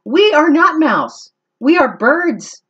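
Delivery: high, loud bird chorus; chirpy birds. Acted. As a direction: chirp it out together. Birds answer.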